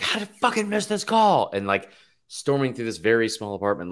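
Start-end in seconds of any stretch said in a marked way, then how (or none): 0.0-1.5 higher mock frustrated yelp; imitating himself losing it over a missed call; retold outburst. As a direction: replay your own panic, pitched up. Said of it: "I was like".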